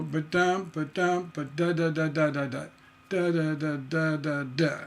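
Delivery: ba-dum syllables scatted in a steady beat; rhythm demo. Shown as a demonstration. Teacher scatting a six-syllable cluster to students. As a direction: scat the rhythm out evenly. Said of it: "That's a sixth one".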